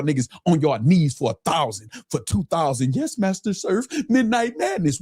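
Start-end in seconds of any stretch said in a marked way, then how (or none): 2.9-5.0 mocking quote. Mocking groveling fans.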